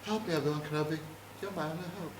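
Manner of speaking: lightly voiced soft plea; a hushed recorded-message imitation; Princess Leia. Leia's recorded message to Obi-Wan, softly pleading. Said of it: "Little message says".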